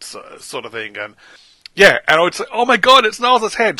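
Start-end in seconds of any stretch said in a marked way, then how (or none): 2.5-3.8 shocked. Retold or imitated. Fan retells shocked discovery.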